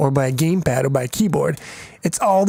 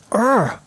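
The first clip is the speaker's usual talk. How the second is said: short annoyed urgh; frustrated groan; 7 semitones higher than usual. Real, not acted. Coder groaning at his own voice. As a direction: grunt of real frustration. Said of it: "I'm getting pissed off".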